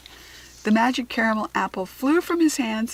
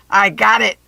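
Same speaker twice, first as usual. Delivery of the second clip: much louder, grabbing goblin shout; goblin shriek; 12 dB louder than usual. A narrator's reading. Goblin snatching the apple from the boy, shrieking. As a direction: shriek it out in triumph. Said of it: "the goblin shrieked".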